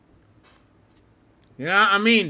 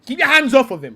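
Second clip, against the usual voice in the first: higher, louder warning on "keep your hands off of him"; emphatic warning; 6 semitones higher, 8 dB louder, faster than usual. Real, not acted. Streamer warning Rice's partner, louder insistent repeat.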